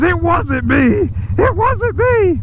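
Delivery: mock indignant denial, high and drawn out; Jeff Jarrett. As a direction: imitate a boss frantically protesting innocence. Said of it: "Jeff Jarrett jumps up and says".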